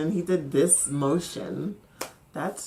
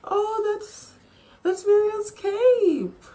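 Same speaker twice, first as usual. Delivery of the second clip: excited; 5 dB louder, slower than usual. Real, not acted. Spotting beloved character's cape.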